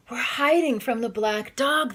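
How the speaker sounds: plain reading voice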